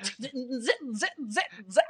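quiet, staccato electronic glitch sounds; soft mouth-made digital buzz; glitch noise imitation. Shown as a demonstration. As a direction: mimic a stuttering broken connection.

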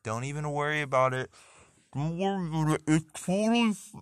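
high, loud, eager toilet voice; cheerfully soothe, then get nosy; reassuring toilet. Pretend. Toilet reassuring its user, high and loud. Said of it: "he's just reassuring you".

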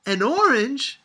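loud, high scornful question; contemptuous. A narrator's reading. Sophia scoffing at grandmother's offer, contemptuous. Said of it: "said Sophia contemptuously".

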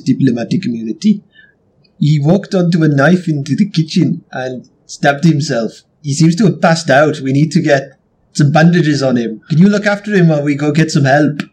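put-on composed South African accent. Player explaining a stabbing to guards, South African accent. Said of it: "only way in which I could ever do accents".

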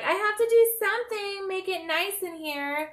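squeaky high impression of a fussy girl; high mock Alexis voice. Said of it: "she was like".

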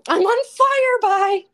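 high, loud squealed repeat of the joke; squealed echo. Pretend. Friend shrieking back a silly excuse, high and loud. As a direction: shriek the line back at her, delighted.

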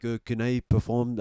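put-on French accent; French character. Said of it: "sign up in character".

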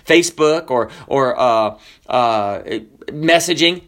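ordinary talk

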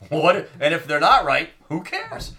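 delighted rhetorical quip; gleeful mock question. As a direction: toss it off like it hardly matters. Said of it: "that's basically what he asked".

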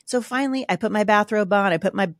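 normal talking voice

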